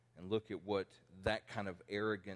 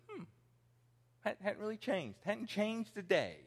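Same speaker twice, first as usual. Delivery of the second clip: put-on vain old man; 10 semitones higher than usual. Old man admiring himself in mirror.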